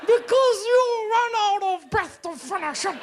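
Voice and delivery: loud, very high, drawn-out Maori accent; Maori accent, starting too high. Shown as a demonstration. Comic demonstrating the Maori tip, straining high accent. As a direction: push the Maori lilt high until breath runs out. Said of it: "not start your sentence too high".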